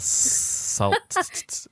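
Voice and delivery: very high breathy whisper of Salt; high whispered squeak. Pretend. Playful repeat.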